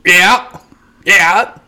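high drawn-out yeahs, lightly mocked; mock phone talker. Mocking the man on the phone, a high repeated yeah. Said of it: "he's just like".

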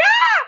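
sharp high yelp of fright; retold startled scream; startled shriek. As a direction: yelp like something just jumped at you. Startled by creature on ground.

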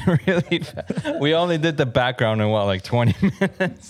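can't stop laughing while protesting. Real, not acted. Teasing "really fast" request.

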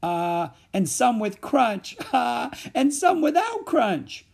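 high, loud, slow list with laughs; gleeful drawn-out hollering; laughing taunt. A narrator's reading. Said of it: "the laughter beginning again".

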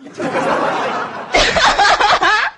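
long high-pitched laugh; delighted laughing. Real, not acted. Caller teased by host, high delighted laughing. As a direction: burst into helpless giggles. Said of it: "她还乐上了".

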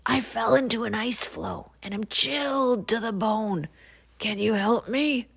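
a frozen beast begging, loud and high; pleading wolverine. Acted. Wolverine begging Cozy for help, loud and high. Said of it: "it growled".

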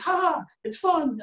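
high, playful giggly tone; playful inner voice. Retold or imitated. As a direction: tease like a playful spirit. Inner voice playing.

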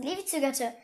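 ordinary delivery

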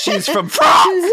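shouted high and slow; loud exaggerated France. Correcting Kaylin about the contestant, slow loud shout. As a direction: belt France like the pageant queen.

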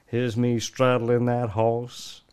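very slow proud cowboy drawl; lightly hammed Texan boasting; boastful Texan. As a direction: show off like a rodeo star. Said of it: "He said".

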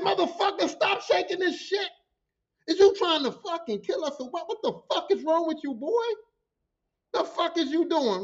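high-pitched, incredulous telling-off; exasperated scolding. Retold or imitated. Retelling scolding a coworker for shaking the lift, high and exasperated.

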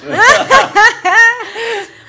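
high, loud burst of laughter; real laughing fit. Real, not acted. (gargalhada alta)

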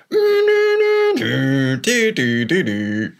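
high beeping fax noises; fax machine impression. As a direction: beep out a fax tone, starting high. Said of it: "Can I do an impression of a fax machine".

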